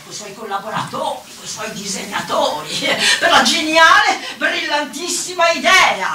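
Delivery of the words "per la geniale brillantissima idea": high, loud, drawn-out mock praise; mocking sarcasm. Woman mocking his brilliant idea, loud sarcasm. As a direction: sneer at the idea with inflated grandeur.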